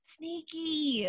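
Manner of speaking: high, hushed "Sneaky."; ninja whisper. Acted. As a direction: breathe "Sneaky." high and soft. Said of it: "puts his paw to his mouth".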